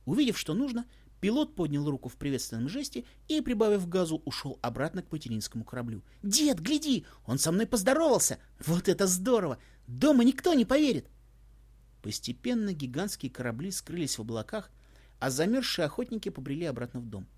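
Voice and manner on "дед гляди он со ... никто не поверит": high, loud, joyful; thrilled. A narrator's reading. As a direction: burst with delight. Boy thrilled by pilot greeting. (восторженно, громко)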